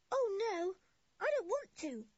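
high, loud, horrified cry; horrified protest. A narrator's reading. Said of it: "said Anne in a horrified voice".

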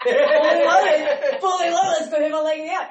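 loud laughing pitch, stretched then fast; mock dealer hawking. Pretending to sell from her leg, loud and laughing. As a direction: hawk it like a street dealer.

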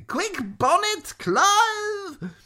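high, loud, urgent call; shrieking. A narrator's reading. Maudmont calling Bonnet and Clive, urgent shriek. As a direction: shriek orders to the henchmen. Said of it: "shrieked Maudmont".

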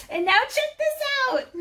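very high, loud squeal of anticipation; excited reveal. A narrator's reading. Mari about to show Rooney the bell, high and excited. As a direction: build up a big surprise in a high voice.

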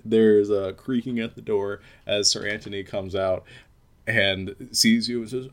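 ordinary talk

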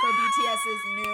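high, loud hype shout; excited shout. Real, not acted. Host announcing the BTS trailer, a loud excited yell.

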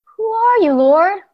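high, loud, frightened cry; Saul's fearful question. Acted. Fallen Saul calls up to the heavenly voice, high frightened cry. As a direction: cry out up to the light in awe. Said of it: "So Saul asked".

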